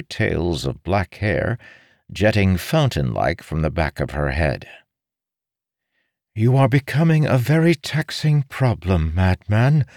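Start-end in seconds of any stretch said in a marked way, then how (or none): 6.3-9.9 high-pitched, reproving lady; formal female voice, displeased; stern noblewoman. Acted. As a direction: address him as a troublesome nuisance.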